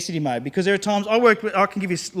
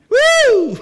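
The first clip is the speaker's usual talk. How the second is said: sarcastic whoop, jumping much higher and louder; mock cheer; 9 dB louder than usual. Speaker mocks a two-fifty discount to the audience with a high, loud, sarcastic whoop. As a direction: fake thrill over a tiny saving, whooping high.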